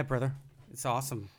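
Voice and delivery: ordinary talk, nothing marked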